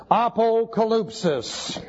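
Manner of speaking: slow, careful Greek "Apocalypsis" as ah-po-ka-LOOP-sis; slow Greek pronunciation. Shown as a demonstration. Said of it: "This is".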